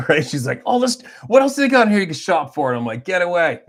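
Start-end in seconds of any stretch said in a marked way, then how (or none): 0.6-2.7 high, loud excited browsing; wife itching to spend the points; eager shopper wife. Retold or imitated. 3.0-3.6 teasing refusal. Retold or imitated. Refusing wife's shopping.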